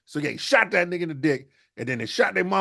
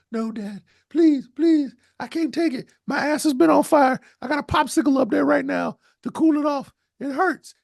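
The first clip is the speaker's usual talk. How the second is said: much higher, pleading comic voice; whiny begging son; 13 semitones higher, 3 dB louder than usual. Acted. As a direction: beg in a high squeaky whine. Son begging his dad.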